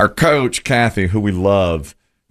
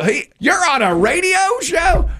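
surprised shout. Retold or imitated. Imitated surprised stranger.

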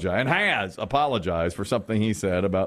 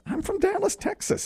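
much higher, gleeful and breathless on Texas; eager boast. Acted. Party invite accepted, eager high-pitched boast. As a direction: squeal the hometown like it's a badge of honor.

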